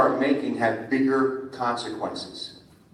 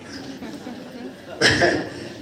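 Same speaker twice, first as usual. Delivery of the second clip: high laugh; 11 semitones higher than usual. Real, not acted. Laughs at own impression.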